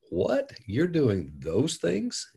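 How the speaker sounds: sharp high "What?" then slow stunned question; reacting to a shocking confession; father stunned by son's admission, incredulous; shocked disbelief.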